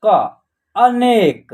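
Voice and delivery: अनेक drawn out slowly, syllable by syllable; slow word blending. Shown as a demonstration. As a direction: stretch अनेक out for learners. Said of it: "न में जो है ए की मात्रा ने".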